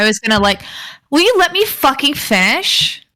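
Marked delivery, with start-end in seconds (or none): 1.1-3.0 higher-pitched, exasperated demand; mock exasperation. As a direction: cut off the heckler with playful indignation. Snaps back at interrupting co-host.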